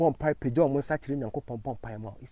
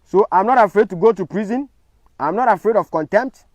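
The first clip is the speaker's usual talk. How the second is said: louder, higher, punching not; defiant declaration; 5 semitones higher, 7 dB louder than usual. Real, not acted.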